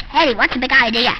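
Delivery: usual speaking voice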